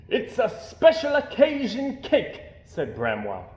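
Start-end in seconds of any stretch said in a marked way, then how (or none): 0.1-2.4 high, loud, slow, laughing bear voice; proud of his messy cake; jolly bear with a laugh. A narrator's reading.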